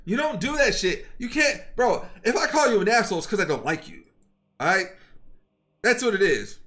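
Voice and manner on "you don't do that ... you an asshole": ordinary talk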